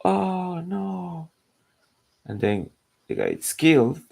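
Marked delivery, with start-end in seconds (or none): 0.0-1.3 high, squeaky mock "oh no"; mock dismay. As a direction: squeal it like a startled crowd. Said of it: "everybody was like".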